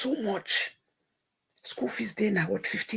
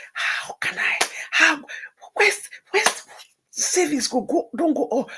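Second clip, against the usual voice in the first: crying despair; 7 semitones higher than usual. Retold or imitated. Retelling crying over money.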